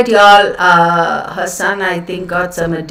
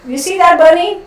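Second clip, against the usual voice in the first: much higher pet voice; 9 semitones higher than usual. Real, not acted. Owner showing her dog something, high baby talk. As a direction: chirp sweetly at the dog.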